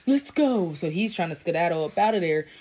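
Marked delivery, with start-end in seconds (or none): 0.1-0.7 higher, coaxing mock voice; coaxing boyfriend. Bystander coaxes his girlfriend to leave, high mock coaxing. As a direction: gently hurry her away, voice raised. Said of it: "the dude is like".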